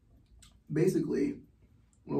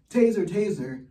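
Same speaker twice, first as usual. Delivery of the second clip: raised, louder mock yell; mock scream; 5 semitones higher, 6 dB louder than usual. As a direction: imitate an officer screaming a warning. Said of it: "you hear her dumbass screaming".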